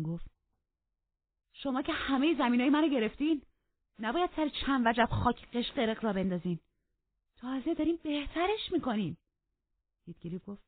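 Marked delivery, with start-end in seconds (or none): 1.5-9.2 high, loud indignant protest; defiant retort. Acted. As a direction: talk back boldly, pitched high. Catherine talks back defiantly in quarrel.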